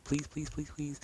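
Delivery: high-pitched anxious "please" on repeat; pleading. Acted. Boy hoping his mom missed the news, high pleading. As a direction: beg under your breath, pitched right up. Worried boy begging in story.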